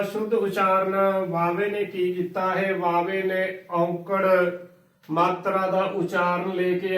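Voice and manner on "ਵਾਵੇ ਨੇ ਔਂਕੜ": usual speaking voice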